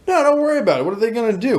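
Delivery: high, breezy brush-off; dismissive. Retold or imitated. Laid-back friend reassures nervous friends, dismissive.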